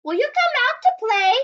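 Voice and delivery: high, loud, fast friendly invite; Dingo asking. Acted. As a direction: call out eagerly to play. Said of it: "When Dingo asked".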